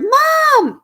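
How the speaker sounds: a sudden high, loud call for mom; hollered. A narrator's reading. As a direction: shout for your mother across the house. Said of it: "I hollered".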